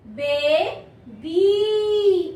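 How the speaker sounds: बेबी very slow, both ए and ई held; long vowels. Shown as a demonstration. Teacher showing pupils the long ई ending, बेबी drawn out slowly. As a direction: stretch "बेबी" into two long syllables. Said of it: "इसको मैंने ई क्यों पढ़ा".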